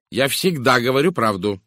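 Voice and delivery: curt retort. A narrator's reading. Woman asked to answer truthfully, curt retort. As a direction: shut the question down firmly. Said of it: "отрезала мисс Говард".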